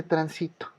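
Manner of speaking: "tránsito" said tran-SI-to, stress on the middle syllable; acento grave. Shown as a demonstration. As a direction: move the stress in "tránsito" onto SI. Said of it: "Una palabra grave". ("tránsito" dicho como palabra grave, tran-SI-to)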